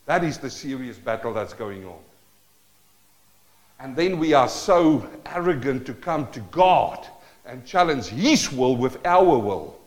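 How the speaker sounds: normal talking voice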